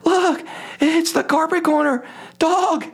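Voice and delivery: very high, thrilled lady's voice; excited woman fan. Retold or imitated. Woman on the plane pointing out the dog, high excited squeal. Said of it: "And she says".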